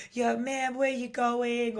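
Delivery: high, drawn-out mock American passer-by; hammed-up easygoing American greeting; friendly American guy. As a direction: toss it out like a chill street guy. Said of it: "they're always like".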